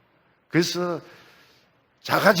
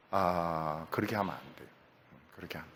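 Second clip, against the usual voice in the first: ordinary talk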